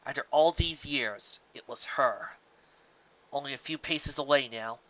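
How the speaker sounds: usual speaking voice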